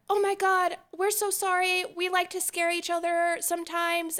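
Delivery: high, fussing apology; apologetic strangers. Retold or imitated. Mimicking the couple apologizing on the stairs, high and flustered. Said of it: "him and the girl are both like".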